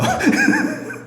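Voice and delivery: high, airy chuckle; amused laugh. Real, not acted. Preacher teasing congregation about their amens, amused laugh. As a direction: laugh at your own remark.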